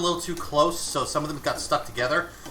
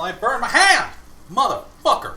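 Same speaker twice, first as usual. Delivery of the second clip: loud, high curse of pain; pained shout; 8 semitones higher, 7 dB louder than usual. Real, not acted. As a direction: shout out at the burn. Burned hand, cursing.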